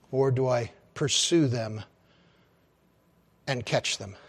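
soft, low, inward prayer; hushed pleading to the Lord; quiet prayer. Acted. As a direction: pray it under your breath.

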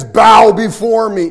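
booming, drawn-out royal command; demanding conquering king. Acted. Conquering king demands the beaten king bow, booming command. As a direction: bellow it slowly as a victor to the defeated. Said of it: "and he demands".

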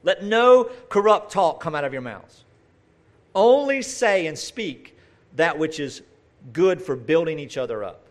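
slow, loud, weighty reading of the verse; preacher reads Ephesians aloud to the congregation, slow and weighty; solemn scripture reading. Shown as a demonstration. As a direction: proclaim it slowly from the pulpit. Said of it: "Ephesians chapter four".

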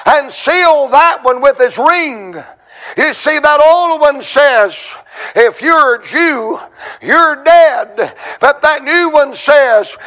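high, ringing pulpit proclamation; full-force preaching. Acted.

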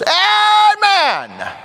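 long, high shouted cheer, falling at the end; usher's shout. Retold or imitated. Usher celebrating a twenty in the offering, high drawn-out shout. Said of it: "the usher goes".

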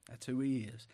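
ordinary delivery

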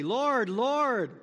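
loud, high, pleading cry of Lord; desperate pleading call; emphatic cry. Retold or imitated. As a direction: cry out Lord with full emotion. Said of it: "very emotionally, very emphatically".

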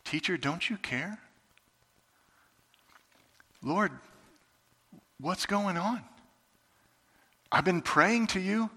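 soft, hurting question to the Lord; hushed plea. Retold or imitated. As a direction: ask it under your breath. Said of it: "tempted to ask Jesus".

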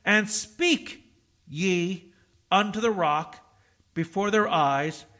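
And speak ye unto the rock before their eyes spaced word by word; measured, pointed scripture reading; slow emphatic reading. Shown as a demonstration. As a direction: space out every phrase for the congregation to mark. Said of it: "you ought to mark this".

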